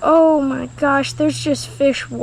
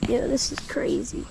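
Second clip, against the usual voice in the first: whisper; 8 dB quieter than usual. Real, not acted. Watching snake eat catfish quietly.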